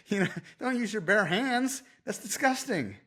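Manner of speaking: high-pitched mock disgust; disgusted scolding. Trainer to client using bare hands, mock disgusted. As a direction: retell an appalled telling-off.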